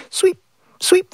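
high, clipped sweeps of a grumpy aunt; crabby aunt sweeping. Acted.

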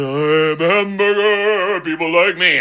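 a cheerful, self-satisfied hamburger voice that breaks into a sing-song la la; cocky little hamburger singing its own praises; smug hamburger. Pretend. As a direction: play the happy food everyone loves, humming to itself. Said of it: "the hamburger would be going".

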